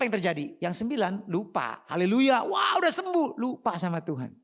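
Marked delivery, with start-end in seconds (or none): 1.9-3.4 high, excited burst of praise; elated cheering. Acted. Healed lepers celebrating and forgetting God, elated cheering. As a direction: whoop it out with raised pitch.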